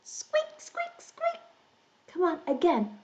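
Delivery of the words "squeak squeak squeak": tiny, high mouse squeaking; mouse squeaks. Shown as a demonstration. Teacher shows children the mouse cue, tiny high squeaks.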